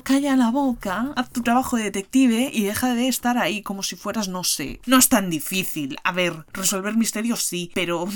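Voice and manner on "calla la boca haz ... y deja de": exasperated. Acted. Exasperated retort at Poirot.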